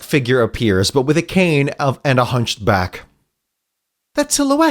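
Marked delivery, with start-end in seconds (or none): 4.1-4.7 high-pitched hero voice; startled young Tails. Acted. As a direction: spot a familiar shape, voice pitched well up. Tails's line in the story.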